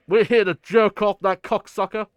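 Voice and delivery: loud, barked military line; mock soldier bellowing the line; gruff army guy. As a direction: bark it like a tough army officer. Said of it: "and he says the line".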